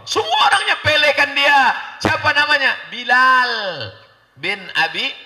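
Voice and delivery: high, loud sermon shout; fiery preaching. Real, not acted. Preacher rousing the crowd about Bilal, loud and high.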